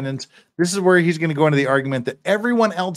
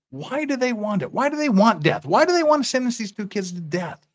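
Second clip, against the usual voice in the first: high-pitched, urgent rhetorical questions; impassioned pleading; 8 semitones higher than usual. Acted. Defender challenging the state's wish for death, impassioned pleading. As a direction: demand an answer, pitched well up. Acted plea against death sentence.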